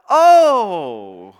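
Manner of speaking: long, loud falling "oh"; hammed-up let-down reaction; taken aback. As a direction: draw out a loud, sinking oh. Acted crowd's let-down reaction.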